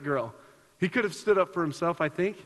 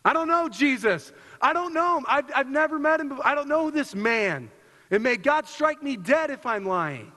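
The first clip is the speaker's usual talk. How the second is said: desperate denial; 6 semitones higher than usual. Acted. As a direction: swear it up and down, panicking. Acting Peter's desperate denial.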